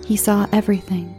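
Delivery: ordinary delivery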